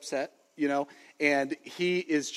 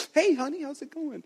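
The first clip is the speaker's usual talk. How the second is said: high-pitched squeak, imitating someone crying; mock tearful greeting; 12 semitones higher than usual. Husband greeting wife who walks in mid-cry, mock tearful. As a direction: greet her through a put-on crying voice. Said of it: "I just broke down crying".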